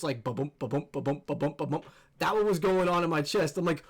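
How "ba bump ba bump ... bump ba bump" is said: low, thumping heartbeat noises; imitated heartbeat. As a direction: thump out a racing pulse. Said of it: "you could feel your heart just".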